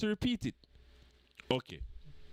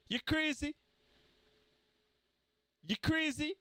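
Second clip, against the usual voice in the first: mock disbelief; 8 semitones higher than usual. Real, not acted. Mocking disbelief at rivals.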